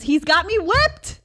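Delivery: high, loud exclamation; emphatic admission. Real, not acted. Mom admits her son controls her, exclaiming.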